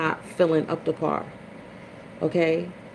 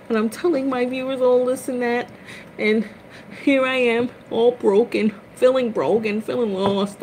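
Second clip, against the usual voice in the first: lament; 7 semitones higher than usual. Real, not acted. Lamenting being broken.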